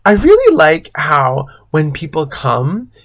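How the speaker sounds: usual speaking voice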